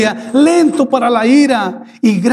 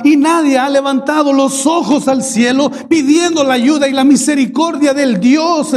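higher, pressing sermon cadence; fervent preaching; 6 semitones higher than usual. Acted. Pastor pleads that nobody looks to heaven, fervent preaching. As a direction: drive the point home to the congregation.

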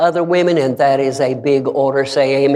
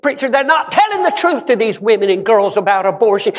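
fervent preaching; 9 semitones higher than usual. Real, not acted. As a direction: preach it high, letting line ends fall away. Preaches with raised, drawn-out voice.

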